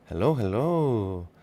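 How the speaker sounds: usual speaking voice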